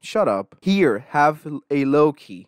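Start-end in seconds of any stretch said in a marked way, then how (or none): none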